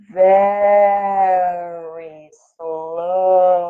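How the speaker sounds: drawn-out punchline. A narrator's reading. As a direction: land the joke by stretching it out. Punchline of snail joke.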